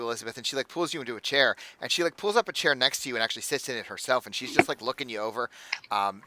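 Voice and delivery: normal talking voice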